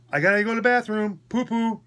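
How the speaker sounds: high-pitched imitation of baby talk. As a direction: mimic a patient pleading in baby talk. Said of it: "He jumps into baby talk mode".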